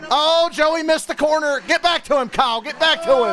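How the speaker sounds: high-pitched, urgent race reaction; excited cheering. Real, not acted. Streamer urging his driver on during the restart, high and excited.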